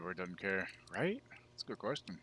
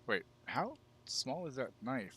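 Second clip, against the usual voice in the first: usual speaking voice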